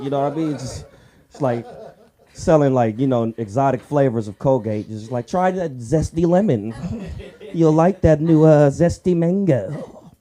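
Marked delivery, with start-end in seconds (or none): 5.3-10.1 higher, slow, salesy model voice; mock toothpaste ad. Comic imitates his principal as an ad model, cheesy salesy voice. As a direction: sell the flavor like a cheesy commercial.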